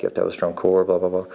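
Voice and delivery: ordinary talk, nothing marked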